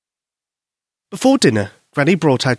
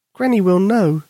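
put-on little girl voice; 7 semitones higher than usual. Susie explains sadly.

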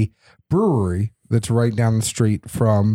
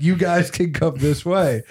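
"You guys can come this way" said higher and a little pointed; lightly exasperated retort, pitched up; mildly exasperated repeat; 7 semitones higher than usual. Retold or imitated. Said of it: "And I'm like".

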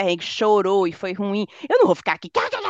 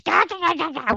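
imitating kids cackling; 8 semitones higher, 3 dB quieter than usual. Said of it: "morrendo de rir".

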